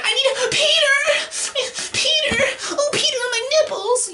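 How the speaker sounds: very high, wailing mock damsel; hammed-up tearful falsetto cry; Gwen Stacy. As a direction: squeal for help in a mocking falsetto. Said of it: "Gwen was like".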